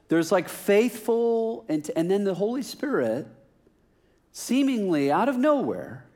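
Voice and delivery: high-pitched, drawn-out mock disbelief; comic exasperation. Preacher baffled by the Spirit appearing through videos, comic exasperation. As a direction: stretch the key words in baffled protest.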